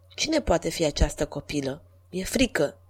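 fearful inner thought. A narrator's reading. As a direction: muse to yourself, quietly afraid. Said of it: "bufonul cugetă".

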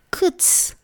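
a high, breathy snapped shoo at the cat; quick voiceless scat; sharp shoo. A narrator's reading. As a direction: snap it at the dark under the table. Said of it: "se stropși doamna Deleanu".

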